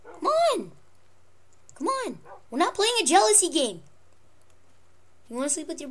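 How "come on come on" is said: high pleading call to pet. Real, not acted. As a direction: plead with the dog to come. Calling pet again.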